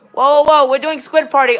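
loud urgent whoa, waving them off; calling out. Real, not acted. Streamer telling lobby it is Squid Party, loud call. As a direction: raise the voice to halt the lobby.

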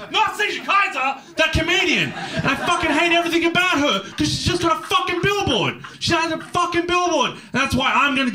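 high, strained comic rant with laughs; a hammed-up fury at the billboard; mock rage. Comedian rants at a rival's billboard, high mock rage.

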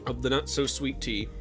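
usual speaking voice

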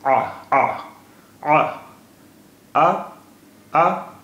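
आ pushed from the back of the throat, rising each time; glottal ain. Shown as a demonstration. Teacher demonstrates the throaty ain sound. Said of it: "गले के पिछले हिस्से से आवाज़ उसकी निकलेगी".

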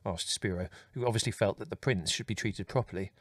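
plain reading voice

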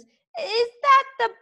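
high, stammering, gleeful taunts; taunting little dragon. A narrator's reading. Toothless mocking Fireworm with stuttered insults. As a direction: pile on the insults, high and cheeky.

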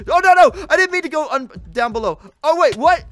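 high, loud panicked cries; panicked yelling. Real, not acted. Gamer panicking at his own fall, loud. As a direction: yell in sudden alarm as the game turns. Panicked mid-fight in game.

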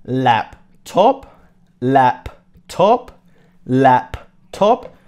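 slow, clear "laptop" said over and over with a rising tune; repeated model word. Shown as a demonstration. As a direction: drill "laptop" for the class, each one rising. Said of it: "We call it laptop".

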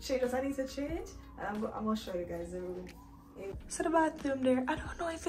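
quiet late-night voice; hushed talking. Real, not acted. Late hotel arrival, hushed.

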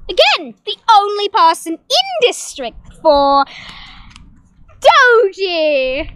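loud game-show announcement; hammed-up loud announcer call; announcer shout. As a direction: boom the name out like a host. Host announcing tribute loudly.

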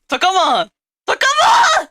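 high crying shriek in panic; wailing in embarrassed panic; panicked shrieking. Real, not acted. Viewer caught streamer's aegyo.

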